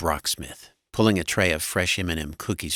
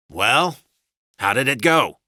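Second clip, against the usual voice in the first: loud, pitched-up husband voice; raised, impatient inquiry; demanding husband; 7 semitones higher, 7 dB louder than usual. A narrator's reading. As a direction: ask it brusquely and loudly. Husband questions wife.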